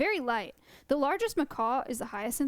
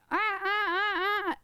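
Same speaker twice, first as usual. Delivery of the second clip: parrot squawk; 8 semitones higher, slower than usual. Retold or imitated. Imitating the parrot Siren screeching.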